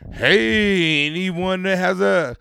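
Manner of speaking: loud, high announcer call with drawn-out words; strip club announcer. Retold or imitated. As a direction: boom it out over the club like an announcer. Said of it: "strip club announcer's like".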